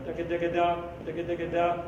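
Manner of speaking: crisp "da ga da ga da" syllables; tongue and throat alternating on "da ga"; double tongue demo. Shown as a demonstration. Showing brass players double tonguing, crisp syllables. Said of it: "The throat does every other note".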